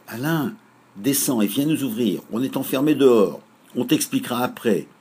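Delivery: loud, high call to the window. Acted. Félix calls up to his brother Alain at the window, loud and high. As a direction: call up to the lit window above. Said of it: "cria Félix à Alain".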